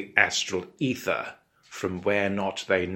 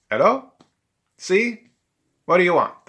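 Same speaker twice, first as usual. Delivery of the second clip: put-on high, loud, accented emperor; Emperor Nero; 11 semitones higher, 8 dB louder than usual. Roman emperor answering prank callers, high loud irritable voice. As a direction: answer like a pestered, crazy emperor. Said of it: "It was Emperor Nero".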